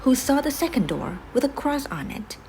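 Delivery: usual speaking voice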